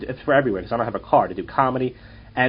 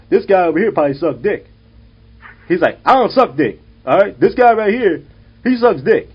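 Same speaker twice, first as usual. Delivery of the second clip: loud, high, hammed-up street heckler; mocking bus guy; 5 semitones higher, 6 dB louder than usual. Toothless man on the bus taunts comedian, loud mock heckling. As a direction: play the toothless guy jeering at a stranger.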